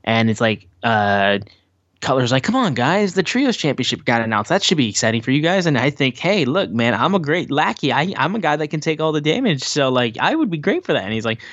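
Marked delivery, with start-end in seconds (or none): 2.4-11.0 higher-pitched, eager pleading pitch; Cutler. Retold or imitated. Lackey pitching himself to his teammates, eager higher voice. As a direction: lightly imitate a lackey selling himself.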